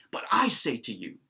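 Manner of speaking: I swelling loud after a quick "But"; weighty emphasis on the word I; stress on I. Shown as a demonstration. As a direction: punch I, let "say to you" drop. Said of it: "See, it's emphasizing the I".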